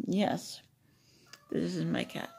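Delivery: usual speaking voice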